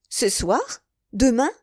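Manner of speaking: jolted out of a reverie; startled exclamation. A narrator's reading. Milady startled by news of the lover's return, exclaiming. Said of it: "s'écria milady".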